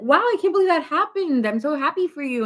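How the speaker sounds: high, bubbly cheer; expressive friend gushing; excited gush. Retold or imitated. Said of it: "you have a lot of expression".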